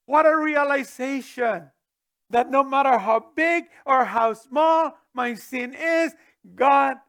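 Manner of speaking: loud, high, swelling proclamation; fervent preaching climax; preaching at full force. Real, not acted. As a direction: lift it to the back pew. Preacher swells at key truth.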